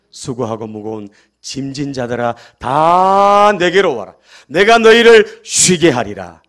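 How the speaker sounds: slow, loud and weighty call; solemn invitation. Acted. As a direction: proclaim it slowly to the weary crowd. Preacher quotes Jesus' invitation. (지친 이들을 부르는 예수님의 느리고 힘찬 선포)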